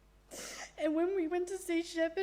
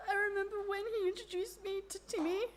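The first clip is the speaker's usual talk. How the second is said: crying; 6 semitones higher, 5 dB quieter, faster than usual. Real, not acted. Eulogy, voice breaks into tears.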